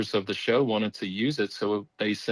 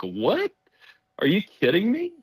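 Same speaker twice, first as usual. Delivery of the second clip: high, stunned disbelief; can't believe what he just heard; a ghost hunter reacts to a shrug, high incredulous; 9 semitones higher than usual. Retold or imitated. Stunned reply to Fred.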